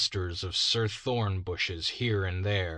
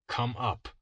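plain reading voice

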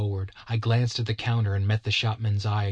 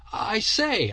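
raised, higher firm call; louder note; 11 semitones higher than usual. A narrator's reading. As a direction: call out to get attention. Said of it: "on a rather louder note".

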